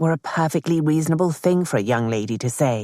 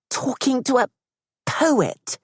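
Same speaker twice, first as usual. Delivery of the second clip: a much higher mother's voice, dripping with disgust; disdainful; 10 semitones higher than usual. Acted. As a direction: say poet as if it were rat or spider. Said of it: "said in the same tone as rat or spider".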